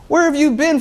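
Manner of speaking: disbelieving cry at his partner's ignorance; mock outrage. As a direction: throw the question back at him, appalled. Repeats question in mock outrage.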